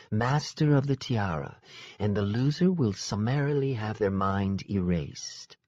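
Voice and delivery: a lightly voiced cool, regal woman; measured, aloof royal explaining the stakes; regal fae sister. Fae sister warns of the duel, coolly regal.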